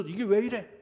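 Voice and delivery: loud, high mocking challenge to God; mock demand. Preacher imitating a believer who talks back to God, shouting. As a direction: throw the complaint up at heaven. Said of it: "어디서".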